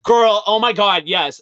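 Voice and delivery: loud, delighted squeal of approval; excited gush. Retold or imitated. Listeners won over by the queer song, loud delighted gush. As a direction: gush like you just discovered it. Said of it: "they're like".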